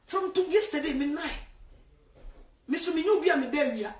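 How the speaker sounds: normal talking voice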